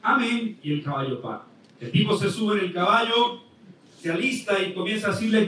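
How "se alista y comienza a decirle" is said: normal talking voice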